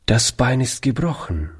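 plain reading voice

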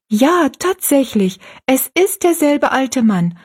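put-on high, slow, emphatic girl; drawn-out, high-pitched confirmation; girl's voice. A narrator's reading. Narrator voices young Rosalie confirming.

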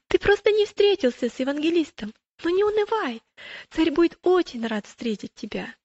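lifted, high, heartening voice. Acted. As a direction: cheer up a doubtful friend.

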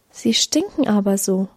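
usual speaking voice

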